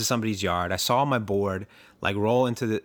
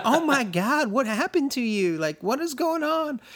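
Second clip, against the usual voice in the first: much higher, alarmed mother's cry; shocked mom. Retold or imitated. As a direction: play the panicked mom seeing her hurt son. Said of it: "she's like".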